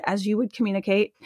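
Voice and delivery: ordinary talk, nothing marked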